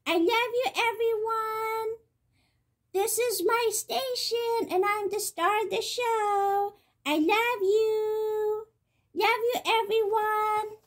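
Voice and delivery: high, slow, cutesy pet voice speaking as the cat; cat's voice. Pretend. Owner speaking as her cat to the viewers, in a high cutesy pet voice. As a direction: put words in the pet's mouth, sweet and slow.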